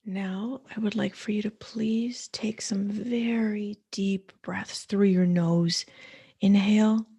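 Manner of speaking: soothing guided meditation voice. Acted. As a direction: ease the listener into stillness, one breath cue at a time.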